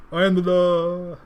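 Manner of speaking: loud, drawn-out, hammed-up Stallone; bad Stallone impression. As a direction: bellow it slowly as a bad Stallone. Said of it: "that was a bad impression".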